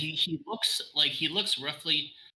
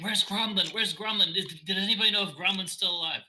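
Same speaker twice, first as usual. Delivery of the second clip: anxious Bethany; 7 semitones higher than usual. Acted. GM voices panicked NPC Bethany.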